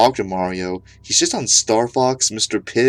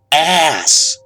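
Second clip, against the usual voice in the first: a loud, higher shout of the word Ass!; yelled ass; 6 semitones higher, 6 dB louder than usual. Acted.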